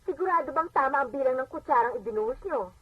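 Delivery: normal talking voice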